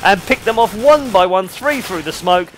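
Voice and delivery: high, loud, excited caster call; play-by-play. Acted. Caster calls the big fake for viewers, loud play-by-play. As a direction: ride the action as it unfolds.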